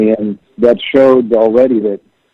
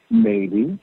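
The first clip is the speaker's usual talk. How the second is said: soft, higher-pitched imitation of a tentative yes; very calm; 9 semitones higher, 7 dB quieter than usual. Bandmate cautiously answering the album proposal, very calm. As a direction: quote him gently, lifted and soft. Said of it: "he very calmly said".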